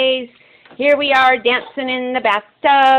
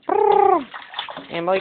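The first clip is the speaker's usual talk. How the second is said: ordinary talk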